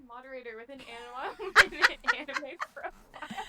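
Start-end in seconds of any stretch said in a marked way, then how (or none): 1.5-3.4 loud, slow words through laughter; laughing. Real, not acted. Cracking up at her own story.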